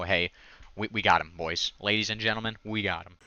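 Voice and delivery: ordinary talk, nothing marked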